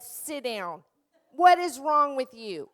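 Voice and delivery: put-on the old strict teacher snapping; angry teacher yelling at class; harsh scolding. Shown as a demonstration. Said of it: "this is what doesn't work".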